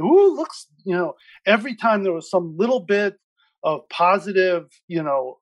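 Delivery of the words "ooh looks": high, louder excited "ooh" in imitation; mock a TV host's eager discovery; Rachel Maddow impression. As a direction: pitch leaps up in mimicked excitement. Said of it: "she kept going back at it and saying".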